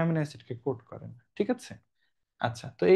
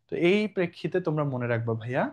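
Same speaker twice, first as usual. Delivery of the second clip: normal talking voice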